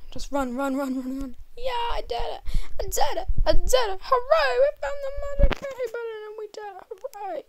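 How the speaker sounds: high, loud victory squeal; excited cheering. Real, not acted. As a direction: squeal with joy at winning. Winning the round.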